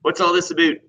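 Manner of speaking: hammed-up Canadian question ending on "a-boot"; Canadian accent. Shown as a demonstration. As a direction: imitate a Canadian asking, "about" rhyming with boot. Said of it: "heard a Canadian say about".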